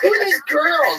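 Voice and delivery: high, slow words through laughter; helpless high-pitched laughing question. Real, not acted. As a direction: ask it while cracking up. Cracking up at the girls joke.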